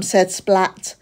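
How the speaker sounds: ordinary delivery, nothing marked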